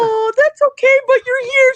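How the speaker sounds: high-pitched gushing; delighted mom. Retold or imitated. Mom happy her daughter is here, delighted. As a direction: imitate a mom thrilled by a visit.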